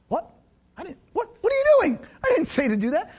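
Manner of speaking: high, squeaky astonished outburst; a baffled squeal of disbelief at the rules; God baffled. Retold or imitated. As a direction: play God bewildered and incredulous. God reacting to church rules.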